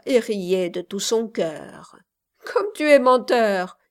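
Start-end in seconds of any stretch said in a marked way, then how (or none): 2.5-3.8 louder, higher, amused accusation; playful teasing. A narrator's reading. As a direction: tease the boaster. Laughing girl teases the boaster.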